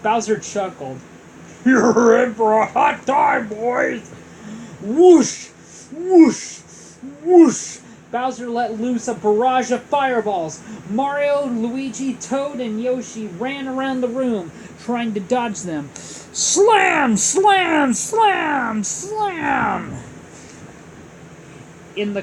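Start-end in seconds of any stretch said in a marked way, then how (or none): 1.6-4.1 loud gloating villain voice; villain taunt. Acted. Bowser taunts heroes. 4.8-7.8 loud whooshing effect; whoosh sound. Acted. 15.9-20.0 loud sound effects. A narrator's reading. Impacts narrated loudly.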